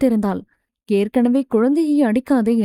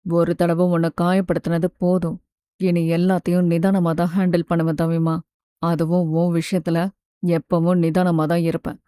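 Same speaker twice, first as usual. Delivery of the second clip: tender husband; 6 semitones lower than usual. Acted. Husband reassures his wife he has changed, low tender voice. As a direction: speak low and sincere, holding her close.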